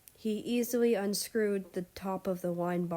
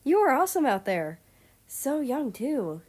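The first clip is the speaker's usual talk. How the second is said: loud, higher and fast; excited praise; 6 semitones higher, 7 dB louder than usual. Acted. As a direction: gush at him with excitement. Guard praises Russia eagerly.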